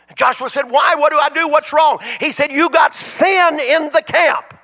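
loud, high sermon storytelling; fiery preaching. Acted. Preacher to congregation, building a loud, high fiery retelling.

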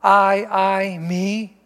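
I, I, me with each word hit hard; stressed pronouns. Shown as a demonstration. Preacher pointing out the pronouns, stressing each one. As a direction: lean on I, I and me. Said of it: "Do you see the pronouns there?".

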